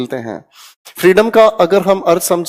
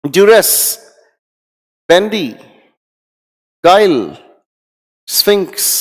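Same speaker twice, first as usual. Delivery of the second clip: loud repeat-after-me drill; 3 semitones higher, 4 dB louder than usual. Shown as a demonstration. Teacher revising words aloud with class, loud calls. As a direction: shout each word for the class to echo. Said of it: "मेरे साथ ऊंची आवाज में बोलने की कोशिश करें".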